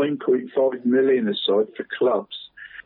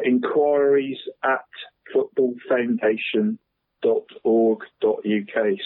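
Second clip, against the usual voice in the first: careful, drawn-out dictation pace; slow dictation. Real, not acted. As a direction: address words spaced out slowly. Repeating the web address.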